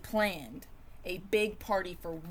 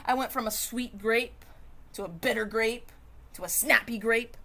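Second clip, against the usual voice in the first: usual speaking voice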